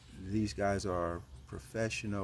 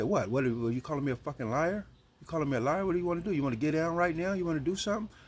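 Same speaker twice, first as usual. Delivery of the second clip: rising, louder, picking a fight; aggressive challenge; 8 semitones higher, 4 dB louder, faster than usual. Retold or imitated. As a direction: get in his face, voice climbing higher and higher. Prison predator provoking a fight.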